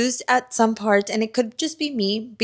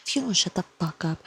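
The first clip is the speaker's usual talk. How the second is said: hushed reactions. Real, not acted. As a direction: keep it hushed so nobody wakes. Late-night hushed reading reactions.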